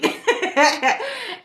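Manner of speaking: real, high-pitched burst of laughter. Real, not acted. Recalling her own naive past self, laughing high. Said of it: "And I'm laughing".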